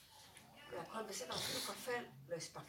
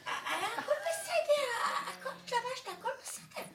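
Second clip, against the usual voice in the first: laughing to tears; 11 dB louder than usual. Real, not acted. Laughing about going out without teeth, tearful laughter. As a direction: gasp it out while laughing helplessly. Helpless laughter after the joke.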